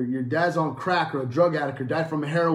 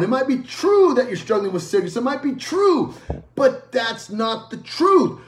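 raised pitch, slow emphatic exhortation; forceful preaching; 6 semitones higher, 6 dB louder, slower than usual. Acted. Preacher urging a congregation to resist the devil, forceful preaching. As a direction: drive each repeated phrase home like a preacher.

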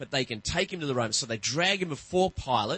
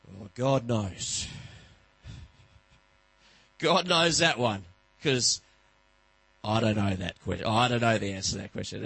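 frustrated dad; 5 semitones lower than usual. Retold or imitated. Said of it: "you're a little bit frustrated and you go".

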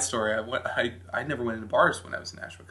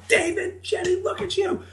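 high, loud mocking old man voice; old barfly; 7 dB louder than usual. Old man at the hotel bar greeting a grown kid, high loud mocking voice. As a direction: squeak it out like a toothless old regular. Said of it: "old guys come up to me and go".

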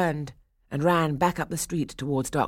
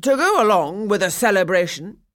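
loud, raised proclamation; cried out; 5 semitones higher, 7 dB louder than usual. A narrator's reading. Burgomaster addressing the town square, crying out. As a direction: proclaim it over the crowd. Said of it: "he cried".

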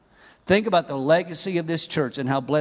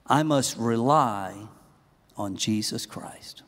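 slow, lowered, deliberate statement; solemn declaration; 6 semitones lower, 4 dB quieter, slower than usual. Real, not acted. Preacher giving the final knockout punch, solemn. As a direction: state it softly and slowly, word by word. Announces the final principle to congregation.

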